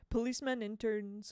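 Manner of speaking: plain reading voice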